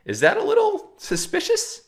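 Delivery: very high, mock-innocent falsetto question; sarcastic. Real, not acted. Nudging viewers to suspect the banks, in a sarcastic falsetto. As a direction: play dumb in a squeaky voice.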